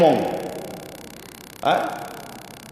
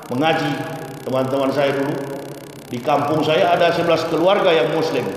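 normal talking voice

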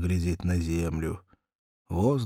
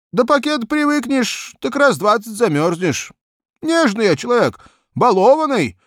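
put-on higher, complaining young cabman; whiny cabman Vanka; 9 dB louder than usual.

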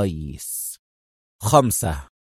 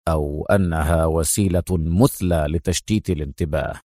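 firm; 8 semitones lower, 4 dB quieter than usual. Acted. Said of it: "قال أدهم في حزم".

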